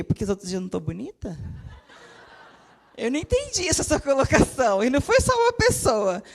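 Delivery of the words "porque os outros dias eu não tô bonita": quick, softer comeback through a laugh; quick amused pretend-offended reply; laughing retort. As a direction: tease back, half laughing. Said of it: "Eu falei".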